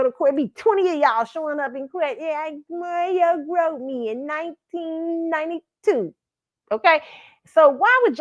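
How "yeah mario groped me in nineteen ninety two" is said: slow, higher-pitched mock testimony; mock accuser. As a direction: drag it out like a witness in court. Mimics accuser testifying in court.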